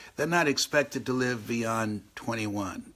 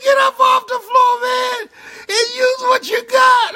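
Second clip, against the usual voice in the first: high, very loud re-enacted order; acted-out shout to get moving; shouted scolding; 12 dB louder than usual. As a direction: yell it like a fed-up friend. Friend scolds him, acted out.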